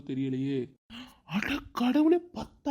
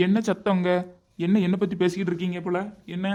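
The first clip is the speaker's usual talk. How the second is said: loud, raised-pitch questioning; father's booming interrogation of squabbling family; stern demand; 3 semitones higher, 8 dB louder than usual. Acted. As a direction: burst in demanding to know the cause. Father walks in on the quarrel.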